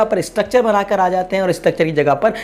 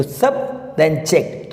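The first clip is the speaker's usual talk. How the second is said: slow, with "सब" and "जेक्ट" set apart; syllable by syllable; 2 semitones lower, much slower than usual. Shown as a demonstration. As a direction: give "सब" and "जेक्ट" one separate beat each. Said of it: "इसको हम दो बार में बोल रहे हैं". ("सब" और "जेक्ट" को धीरे, अलग-अलग बोलें)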